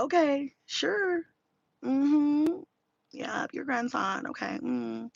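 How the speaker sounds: soft, slow, noncommittal agreement; humouring along. Retold or imitated. Daughter lets grandma talk on phone, softly humouring her. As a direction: drag out quiet yeses to placate a talker.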